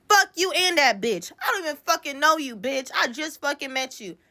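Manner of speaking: high-pitched, indignant cussing out; retold angry telling-off. To the homeboy calling for an apology, retold angry outburst. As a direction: cuss them off, pitch jumping up. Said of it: "I was so fucking mad".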